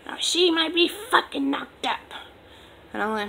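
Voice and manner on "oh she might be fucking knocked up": high, loud mock outburst of anger; retold pissed-off mom. Angry mom answering dad's question, retold loud and high. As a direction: throw it out like a furious mother, imitated. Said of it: "she is pissed the fuck off".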